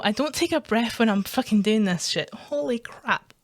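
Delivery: ordinary delivery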